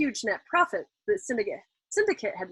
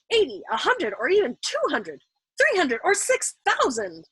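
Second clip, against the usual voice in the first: each number climbs higher, the last slowed and soaring; escalating excitement; 6 semitones higher than usual. Acted. Colonel's mind racing upward, building from eager to giddy. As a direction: let the greed run away with you, building to six thousand.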